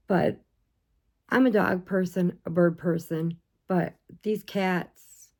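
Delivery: ordinary delivery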